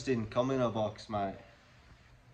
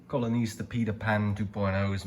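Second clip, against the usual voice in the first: normal talking voice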